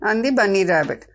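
plain reading voice

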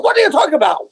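high, incredulous mock Chinese accent. Shopkeeper disbelieving a customer who cannot pay, high Chinese accent. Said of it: "doing my Chinese accent last week".

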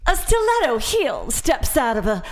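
high-pitched, slow, theatrical narration; mock a professional reader hamming up the story; overacting audiobook reader. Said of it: "the reader annoyed the shit".

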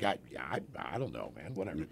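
low, soft, guilty mumble; mumbled like caught out but not caring; sheepish mumble. Retold or imitated. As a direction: shrug it off under your breath. Sheepish reply to bartender.

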